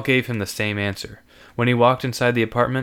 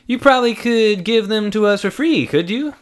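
higher, coaxing plea; wheedling young man; 11 semitones higher, 5 dB louder than usual. Acted. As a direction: sweet-talk a freebie. Andrew wheedling.

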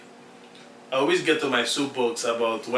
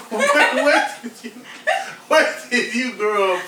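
higher-pitched, laughing disbelief; laughing question; 9 semitones higher, 4 dB louder than usual. Real, not acted. Laughing at a radio host's put-on accent, incredulous and amused. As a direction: ask it through laughter.

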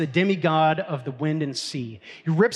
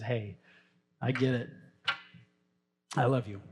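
gentle loving reassurance; 4 semitones lower, 6 dB quieter than usual. Retold or imitated. God welcoming us home.

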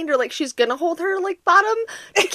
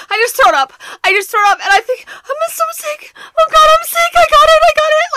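much higher and louder, mock wail; imitated panic crying; 8 dB louder than usual. Partner imitating her sick wife on the phone, crying panic. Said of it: "that's exactly how she sounded".

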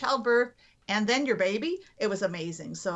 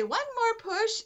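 doctor urging a push; 11 semitones higher, slower than usual. Retold or imitated. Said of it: "doc's getting ready to say".

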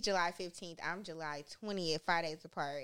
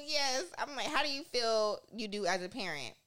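ordinary talk

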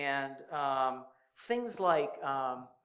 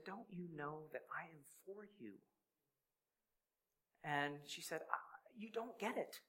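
a soft, higher, tearful plea; a wife pleading through tears with her doubting husband; 4 semitones higher, 7 dB quieter than usual. Retold or imitated. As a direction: quietly beg him through tears. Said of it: "tears running down her face".

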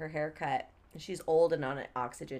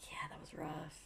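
whispering; 3 semitones lower, 16 dB quieter than usual. Real, not acted. Sardonic aside on rough trip.

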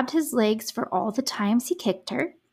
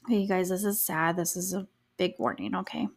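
normal talking voice

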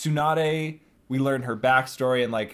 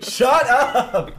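laughing shout; 12 semitones higher, 7 dB louder than usual. Real, not acted. Friends teasing about therapy.

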